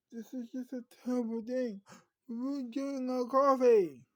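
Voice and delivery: high-pitched complaint building to a shout; acted outraged yell at the end; indignant protest. Acted protest over ruined coffee outing.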